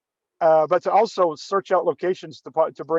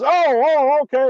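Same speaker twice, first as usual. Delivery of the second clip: high, louder startled "oh, oh"; acting caught off guard, higher and louder; 9 semitones higher, 5 dB louder than usual. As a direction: jumps at the cue in mock surprise. [name] reacts to the fake escape call.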